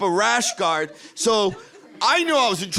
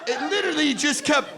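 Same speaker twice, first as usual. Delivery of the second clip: exasperated; 8 semitones higher than usual. Retold or imitated. Exasperated punchline.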